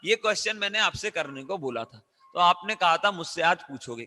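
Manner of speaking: ordinary talk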